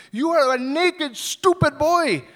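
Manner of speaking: loud, high threatening shout; retold angry yelling. Man following the boy down the road, shouting loud and high. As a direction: chase him off with a yell. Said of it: "he's following after me".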